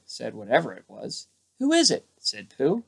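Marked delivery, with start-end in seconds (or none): 1.6-2.1 high voice. A narrator's reading. Pooh asks who is there.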